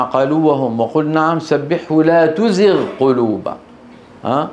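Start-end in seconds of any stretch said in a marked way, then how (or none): 2.0-3.6 تزغ with a distinct gh before the q of قلوبا; clear Ghain. Shown as a demonstration. Teacher quoting the verse rule, غ kept clear. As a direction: keep غ in تزغ apart from ق. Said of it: "الجزري نبه".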